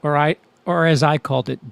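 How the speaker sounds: ordinary talk, nothing marked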